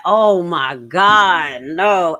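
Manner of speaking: loud, drawn-out mock dismay; mock dismayed reaction. Imagining herself at the chaotic meeting, loud drawn-out mock dismay. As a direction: stretch it out loudly as an imagined reaction. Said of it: "imagine my ass would be like".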